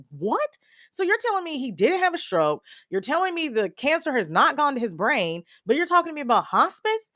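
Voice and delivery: high-pitched retold disbelief; incredulous. Daughter questioning doctors about hospice for Dad, incredulous. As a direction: question each claim in rising astonishment.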